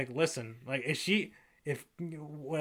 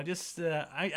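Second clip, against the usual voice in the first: ordinary delivery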